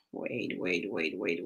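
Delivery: very soft and low, far from the mic; muttered wait. Real, not acted. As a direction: mutter it while fixing the stream.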